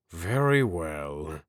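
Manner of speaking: slow, grudging agreement; annoyed but reasonable. A narrator's reading. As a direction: give in slowly, swallowing annoyance. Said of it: "looking annoyed but reasonable".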